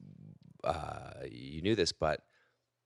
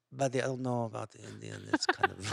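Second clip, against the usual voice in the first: lightly put-on voice of a hesitant club boss; slightly raised, imitated objection; Jamie impression; 4 semitones higher than usual. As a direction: voice a doubtful boss, a little higher. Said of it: "Jim was like".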